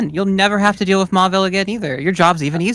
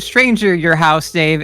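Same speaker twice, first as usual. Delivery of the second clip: normal talking voice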